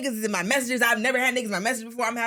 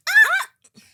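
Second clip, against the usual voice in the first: very high, loud startled shriek; mock scream; 10 dB louder than usual. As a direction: shriek like the girls caught off guard. Dad wants to join, girls scream.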